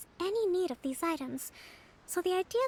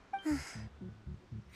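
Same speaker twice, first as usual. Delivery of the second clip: quiet, low tired sigh; soft weary sigh; 8 semitones lower, 9 dB quieter than usual. Acted. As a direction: let out a heavy sigh.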